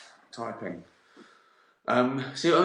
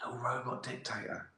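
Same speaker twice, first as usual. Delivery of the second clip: much softer, savoring "robot dictator"; hushed delight; 10 dB quieter than usual. Shown as a demonstration. Delighted at his own phrase, says it softly. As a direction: breathe it like a treasured new idea.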